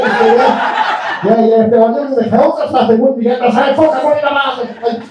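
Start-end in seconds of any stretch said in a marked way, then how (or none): none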